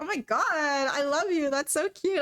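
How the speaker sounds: mock swooning girl; imitating the girl. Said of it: "She'd be like".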